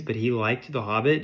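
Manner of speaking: ordinary delivery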